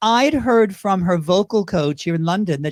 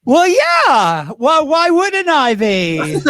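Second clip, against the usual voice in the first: high, loud, drawn-out comeback; mock indignation; 7 semitones higher, 6 dB louder, much slower than usual. Drag performer answering interviewer's bingo jab, loud mock indignation. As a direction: stretch it out loudly, playfully affronted.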